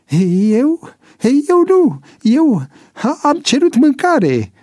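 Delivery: high-pitched, loud panicked stammer; stammering denial. A narrator's reading. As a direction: protest innocence in a squeal of fright. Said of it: "În timp ce se bâlbâia". (bâlbâială speriată, pe ton înalt)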